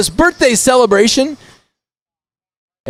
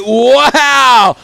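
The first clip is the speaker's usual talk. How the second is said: excited wow; 4 semitones higher, 3 dB louder than usual. Real, not acted. Reacting to sprint win.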